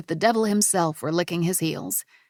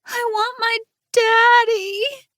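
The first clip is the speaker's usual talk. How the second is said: put-on high, slow little-girl whine drawing out daddy; 7 dB louder, much slower than usual.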